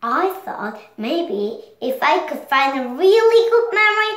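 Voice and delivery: high, fast hopeful rush; eager Bing Bong. Acted. Bing Bong hoping Riley will remember him, eager. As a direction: tumble out the words with hope.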